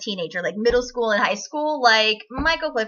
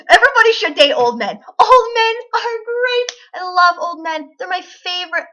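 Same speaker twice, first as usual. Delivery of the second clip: giddy, higher and louder praise; gushing; 7 semitones higher, 7 dB louder than usual. Real, not acted. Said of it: "Why the fuck don't you?".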